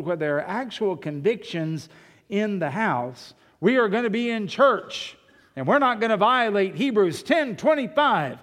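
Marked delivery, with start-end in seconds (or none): 3.6-8.4 higher, louder, emphatic household decree; parental pronouncement. Acted. Parent declares the church rule for the house, firm pronouncement. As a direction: lay down the law as a parent. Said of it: "make a pronouncement".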